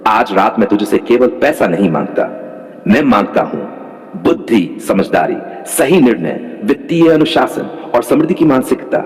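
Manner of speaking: ordinary delivery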